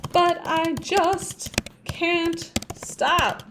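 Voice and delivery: "but I just can't stop" drawn out slowly with weight on the last three words; stressed, slow ending. Shown as a demonstration. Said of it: "We're gonna put more stress on these three words".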